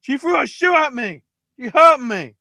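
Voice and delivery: high-pitched fake injured wrestler; hammed-up cry of outrage; mock victim wail. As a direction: whine to the ref like a faker. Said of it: "falls to the floor and goes".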